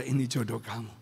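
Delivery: low, soft, solemn; calm divine reply. Retold or imitated. Holy Spirit answers the pastor's protest, low and soft. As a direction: drop to a low, soft, sure voice.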